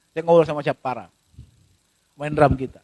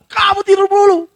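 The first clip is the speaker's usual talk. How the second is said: high-pitched angry order; angry parent; 7 semitones higher, 4 dB louder than usual. Acted. Parent taking it out on a child lying down, angry order.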